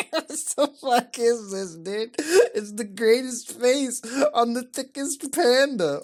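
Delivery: high, slow words squeezed through laughter; amused, drawn-out disbelief; laughing disbelief. Real, not acted. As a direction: talk while cracking up. Cracking up at panda picture.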